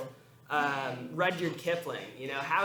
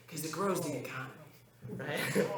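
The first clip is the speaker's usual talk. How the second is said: Biden impression; 4 dB quieter than usual. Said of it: "I'm just gonna do my Biden".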